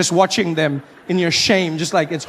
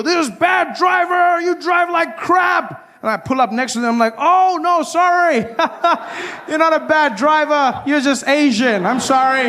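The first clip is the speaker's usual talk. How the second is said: hypothetical yelling driver; 9 semitones higher than usual. Pretend. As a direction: shout at the other car, then fake an apology. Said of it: "and I go".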